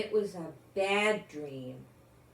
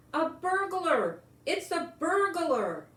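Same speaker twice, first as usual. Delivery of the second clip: much higher, louder cry of alarm; shouted alarm; 9 semitones higher, 5 dB louder than usual. A narrator's reading. Mister Thomas shouting at the burglar, much higher and louder. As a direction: raise the alarm, high and loud.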